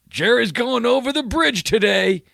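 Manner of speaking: high, loud local with an odd twang; small-town local. Retold or imitated. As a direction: announce it like a grand voyage. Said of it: "And the guy goes".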